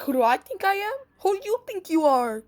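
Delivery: loud, high, cocky retort; sassy comeback. Retold or imitated. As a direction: throw it back in her face. She fires back at the girl.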